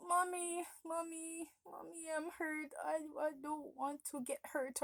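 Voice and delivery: high, soft whimper to mommy; a little girl whimpering to her mom on the bed; whimpering child. Retold or imitated. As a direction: plead like a small hurt kid. Child begging mother while hurt.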